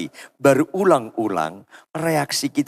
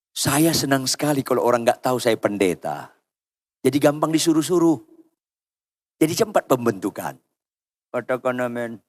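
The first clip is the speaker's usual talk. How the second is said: ordinary talk, nothing marked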